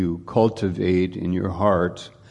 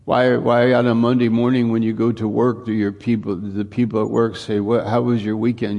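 preaching louder and faster, building up; rising preaching; 2 semitones higher, 4 dB louder, faster than usual. Acted. Pastor asking the congregation about Monday at work, building to a louder, faster preach. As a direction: drive the question home to the congregation.

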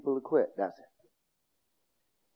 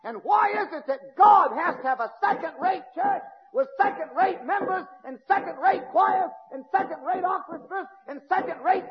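fiery preaching; 13 semitones higher than usual. Real, not acted. Preacher rails against second-rate church.

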